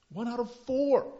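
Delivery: high, loud "One out of four"; emphatic repeat. Real, not acted. Pastor stressing the statistic to congregation, emphatic. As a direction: punch the statistic.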